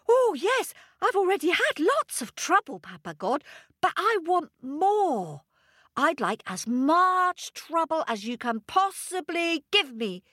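high, quick, eager voice; eager little monkey. Acted. Little monkey pleading with Papa God, high and eager.

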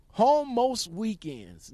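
slow, loud, skeptical emphasis; doubtful drawn-out read. Real, not acted. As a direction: read it like you don't believe it. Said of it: "gotta put a question mark on that one".

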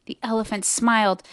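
ordinary delivery, nothing marked

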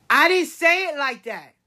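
high, indignant child imitation; protesting child. Granddaughter Leia defending herself to grandmother, protesting child. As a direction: mimic a little girl objecting.

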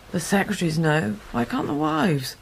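ordinary talk

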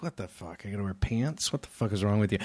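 low, baffled what the fuck; imitating a baffled young comic; incredulous. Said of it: "you look at him like".

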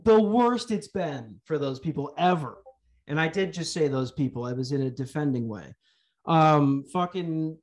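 ordinary talk

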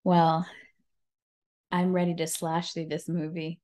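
ordinary delivery

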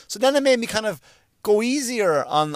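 ordinary delivery

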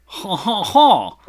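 hammed-up French "haw haw haw" laugh; a stagey Frenchman's chuckle, last one loudest; French accent. [name] reading the part of the Frenchman, hammed-up French accent. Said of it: "gonna read the part of the forty-four-year-old Frenchman".

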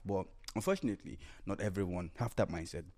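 ordinary talk, nothing marked

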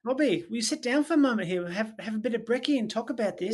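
chummy Aussie manager impression; Australian boss. Café manager sitting Robbie down over brekkie, Australian accent. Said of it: "Literally, that was him".

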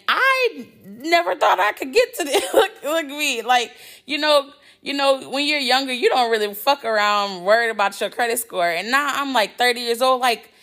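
high, giddy, laughing with joy; bubbling over with excitement; excited laughing. Real, not acted. Excited laughing about credit score.

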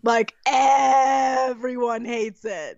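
slow, laughing half-shout. Real, not acted. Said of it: "laugh shout".

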